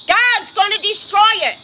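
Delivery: ordinary delivery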